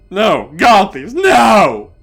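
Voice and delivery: high, loud cry of protest; desperate pleading. Acted. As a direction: beg at the top of your voice. Begging not to leave.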